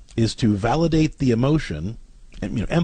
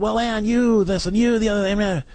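mocking the angry husband; 9 semitones higher than usual. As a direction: imitate him nagging, pitched way up. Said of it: "at first he's gonna be like".

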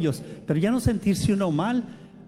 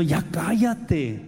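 irritated hush; much slower than usual. Retold or imitated.

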